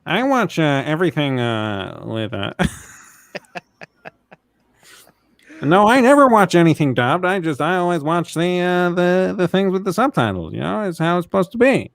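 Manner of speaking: teasing a friend by imitating him, lightly put-on; mock impression of James. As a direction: play James as a fussy subtitle purist. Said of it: "let's do the reenactment of James".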